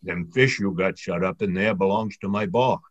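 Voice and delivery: low, surly monster voice; a lightly voiced cross sea serpent growl; low and gruff, surly and cross. A narrator's reading. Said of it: "kind of surly and cross".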